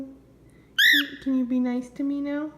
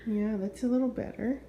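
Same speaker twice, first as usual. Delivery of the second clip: normal talking voice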